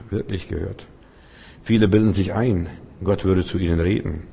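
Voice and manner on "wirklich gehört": "wirklich gehört" much softer, fading; soft repeat. Shown as a demonstration. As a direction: leise nachhallen lassen. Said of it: "ich meine, wirklich gehört". ("wirklich gehört" viel leiser wiederholen)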